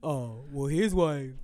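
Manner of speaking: low, dopey caveman voice for husband; caveman Dumbo voice. Retold or imitated. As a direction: play the husband as a dim caveman. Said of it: "why I gotta get the caveman Dumbo voice?".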